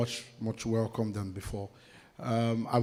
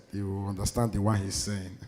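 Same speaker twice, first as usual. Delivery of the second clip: ordinary delivery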